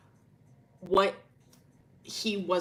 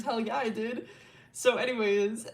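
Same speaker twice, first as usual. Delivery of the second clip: usual speaking voice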